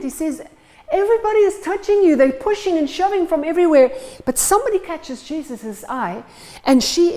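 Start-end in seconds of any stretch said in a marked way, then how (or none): 0.9-3.9 high, loud and fast, exasperated; indignant Peter. Acted. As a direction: protest that the crowd is everywhere. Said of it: "is indignant".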